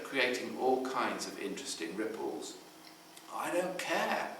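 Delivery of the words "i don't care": put-on defiant teen. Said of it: "she keeps saying".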